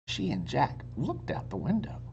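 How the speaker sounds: ordinary delivery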